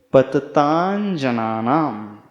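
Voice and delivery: पततांजनानाम said slowly with a palatal nasal before ज; joined sandhi reading. Shown as a demonstration. Teacher slowly models the joined reading पततांजनानाम. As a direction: draw out पततांजनानाम as one word. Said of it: "यानी च वर्ग का अंतिम अक्षर".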